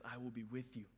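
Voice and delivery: soft, low, gentle reassurance; a quiet, tender God answering; hushed voice of God. Acted. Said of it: "God says".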